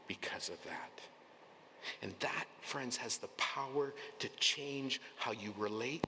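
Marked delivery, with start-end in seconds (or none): 0.1-1.0 hushed, breathy whisper. Real, not acted. Preacher to congregation about being known, whispered. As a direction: let it drop to a whisper.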